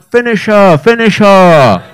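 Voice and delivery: high, loud fight-crowd chant, last one drawn out; crowd egging on. Acted. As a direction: bellow it like neighbours baying for a knockout. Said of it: "All the neighbours were stood around. They were like".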